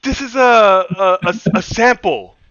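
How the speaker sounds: high, loud, drawn-out excuse; loud mock protest. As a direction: protest slowly at full volume to the wife. Acted excuse to screaming wife.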